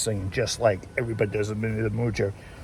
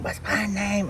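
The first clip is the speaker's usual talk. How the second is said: high, soft Jagger line; imitating [name]; 9 semitones higher, 5 dB quieter, slower than usual. Vlogger quoting [name] in a high soft voice. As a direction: sing out a breathy rock-star ask. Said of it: "[name] saying".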